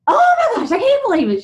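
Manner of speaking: high, loud squeal of disbelief; excited gushing. Retold or imitated. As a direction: imitate a thrilled, over-the-moon reaction. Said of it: "she got so excited".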